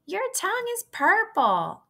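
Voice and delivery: put-on very high girlish squeal; screaming. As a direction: squeal it with glee. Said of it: "screamed Fern".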